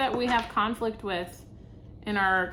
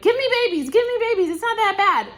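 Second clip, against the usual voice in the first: much higher, louder mock grandparent begging; pushy grandparents; 11 semitones higher, 7 dB louder than usual. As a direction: beg like an eager grandma. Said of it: "all the grandparents are like".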